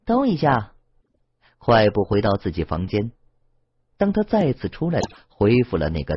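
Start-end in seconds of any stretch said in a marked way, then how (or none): 0.0-0.7 lightly raised call to wait. A narrator's reading. Guihu calling after Yang Luo to wait, lightly raised call. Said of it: "鬼狐喊道".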